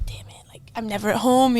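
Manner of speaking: breathless whispered aside. Retold or imitated. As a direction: hush it like a secret confession. Retold inner thought whispered.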